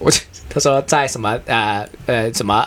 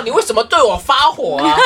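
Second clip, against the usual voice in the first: higher-pitched, mock bewildered protest; baffled; 7 semitones higher than usual. Bewildered friend answering an unexpected outburst, mildly mocked bafflement. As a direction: imitate someone puzzled at being scolded. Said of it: "他就很莫名其妙".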